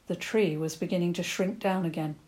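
plain reading voice

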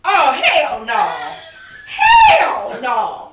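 loud laughing shout. Real, not acted. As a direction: shout it out, outraged. Reacting loudly to weed as drug.

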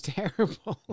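ordinary talk

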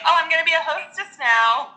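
high, bright mock hostess voice; playful high hostess impression; chirpy hostess. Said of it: "she was just like".

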